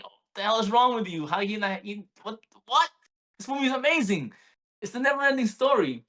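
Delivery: can't believe they don't love it; incredulous outburst. Retold or imitated. Dad to his bored kids, high incredulous protest. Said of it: "to hear that crushed me".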